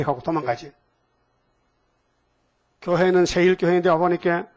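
usual speaking voice

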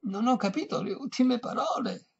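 high-pitched deaf person's call. A narrator's reading. Deaf old lady to violinist, high calling voice. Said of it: "gridò, come se sordo fosse l'altro".

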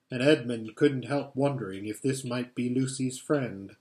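plain reading voice

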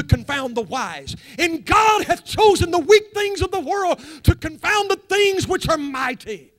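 reading the verse out loud and high, swelling; thundering King James reading from the pulpit; fiery scripture reading. Acted. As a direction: declaim the verses like a revival preacher. Scripture read at full force.

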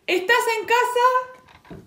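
high, loud, slow question called from outside; hollered question; shouted call. A narrator's reading. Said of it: "gritó alguien desde afuera".

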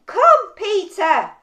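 slow, loud, drawn-out call. Acted. Jesus answering Peter from across the water, loud slow call. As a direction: call across the water, stretching each word. Voice calls back across the storm.

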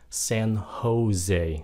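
SAN JOSE as "san ho-ZAY". Shown as a demonstration. Teacher models the Spanish name, "san ho-ZAY" for SAN JOSE. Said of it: "phát âm rằng là âm H".

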